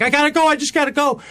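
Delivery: high, tense self-urging; steeling himself. Retold or imitated. Talking himself up the icy turn, high tense urging. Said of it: "I'm like".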